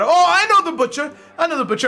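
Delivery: a rowdy crowd cheering for the Butcher; crowd shout. Acted. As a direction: shout it like half the room at once. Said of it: "who shout".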